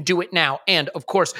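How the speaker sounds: ordinary delivery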